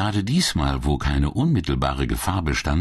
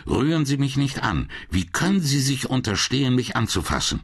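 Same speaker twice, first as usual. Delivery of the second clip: high, sharp indignant protest; angry refusal; 9 semitones higher than usual. Acted. Young woman rebuffing an unwanted touch, angry and high. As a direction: snap it back, fiercely offended and much higher. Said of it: "Zornig riss sie sich los".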